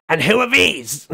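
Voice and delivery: put-on an old woman demanding who the strangers are; suspicious old lady. Old lady eyeing the Western visitors, sharp and suspicious. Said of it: "looks back at Kickflip and just goes".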